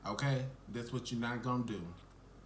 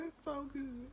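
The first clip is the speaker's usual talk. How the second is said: softer high-pitched rapture; high swoon; 13 semitones higher, 6 dB quieter than usual. Real, not acted. Reviewer still raving, soft high swoon. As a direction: sigh it out high and dreamy.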